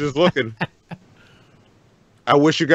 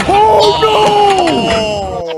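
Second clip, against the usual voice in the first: high, loud drawn-out cry of dismay; shocked yell; 4 dB louder than usual. Real, not acted. Viewer watching someone fall on video, shocked yell. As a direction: shout it high as you watch the disaster.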